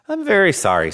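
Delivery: high, apologetic little engine; a small sorry engine voice. A narrator's reading. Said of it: "said the little yellow engine".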